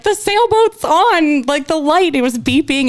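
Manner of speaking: high, slow, bewildered; confused high voice. Retold or imitated. Calling her husband from the car, high and confused. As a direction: stretch it out, lost and puzzled. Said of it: "I'm like".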